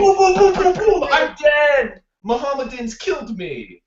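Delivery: high, hammed-up shouting; mock dying cry. Student acting out being killed in a joke, hammed-up cries. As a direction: shriek out a comic death at high pitch.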